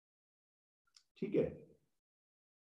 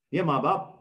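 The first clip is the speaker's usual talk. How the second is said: ordinary delivery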